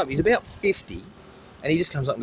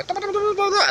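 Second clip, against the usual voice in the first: much higher, excited mock calling; excited locals calling. Locals rushing the fishing boats' catch, high excited calls. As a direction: squeal it out like eager locals at the catch. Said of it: "all the little locals go".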